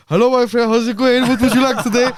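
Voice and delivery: high, loud Turkish shopkeeper voice in a voice note; Turkish shopkeeper accent. Retold or imitated. Sending a voice note to Bills in a Turkish accent. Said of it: "anything in a Turkish accent".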